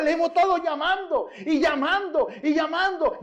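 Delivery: high, hurried indignant nurse voice; nurse's exasperated retort. Retold or imitated. To the nurse, cardiology nurse insists, high and exasperated. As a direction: protest that they kept calling.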